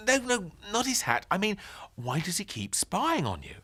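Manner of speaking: put-on boy voice. A narrator's reading. Ben asking Granny about the neighbour, boyish.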